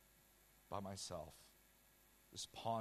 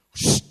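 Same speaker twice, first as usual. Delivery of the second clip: sharp, loud whoosh; a sudden burst of rushing air; whoosh sound; 26 dB louder than usual. Retold or imitated. Suddenly back in the room, a loud whoosh.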